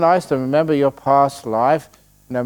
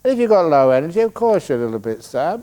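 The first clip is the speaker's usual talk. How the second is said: ordinary talk, nothing marked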